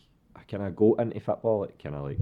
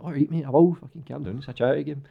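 high, louder fed-up protest; exasperated; 9 semitones higher, 7 dB louder than usual. Retold or imitated. Player telling a screaming teammate to calm down, high and exasperated. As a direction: tell him to calm down, high and irritated. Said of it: "I'm like".

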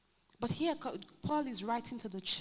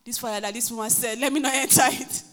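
mock frightened congregant; 8 dB louder than usual. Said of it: "everybody will come and go like".

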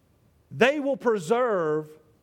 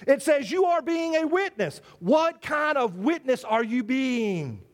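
emphatic preaching; 4 semitones higher, slower than usual. Acted. Preacher telling the church everyone is a witness, with rising emphasis. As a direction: hammer the point to the pews.

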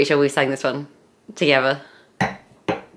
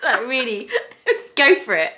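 high, giggling encouragement; laughing; 8 semitones higher than usual. Real, not acted. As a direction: laugh while urging them on. Laughing at fart mouth.

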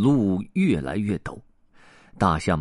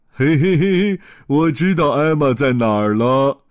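chuckling knowingly; 6 semitones higher, 7 dB louder than usual. Acted. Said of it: "另一头大象笑着说".

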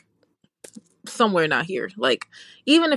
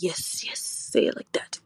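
breathy, savoring whisper; whispered delight; 4 semitones lower, 6 dB quieter, slower than usual. Retold or imitated. Urging someone to say her name their way, whispered relish.

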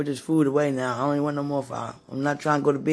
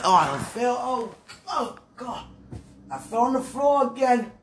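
higher, lightly hammed-up cry of someone who fell; a light put-on voice of someone fallen and groaning; mock fallen lady; 7 semitones higher than usual. Shown as a demonstration. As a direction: whine it out like you just hit the floor. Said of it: "See, when I talk like".

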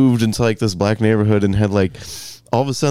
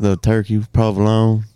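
ordinary talk

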